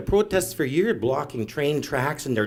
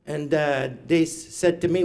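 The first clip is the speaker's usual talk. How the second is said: ordinary delivery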